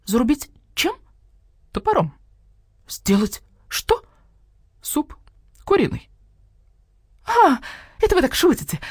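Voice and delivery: the hen refusing to believe; disbelieving hen. Acted. (недоверчивая курица, очень высоко)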